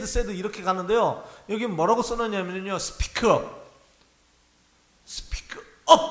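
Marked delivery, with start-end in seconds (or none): 5.1-6.0 크게 속삭이듯 힘준 외침; 큰 소리로 말하라는 시범; loud. Shown as a demonstration. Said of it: "큰 소리로 말하다". (크게 외치듯)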